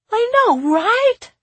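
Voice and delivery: very high, loud, stretched-out question; piercingly high little-kid echo; child jumping in. A narrator's reading. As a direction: squeal the catchphrase as an excited kid. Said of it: "Timby jumped in".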